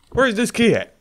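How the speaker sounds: high, loud frustrated demand; exasperated yell. Real, not acted. Player searching for the key, exasperated yell. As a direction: shout it out in frustration.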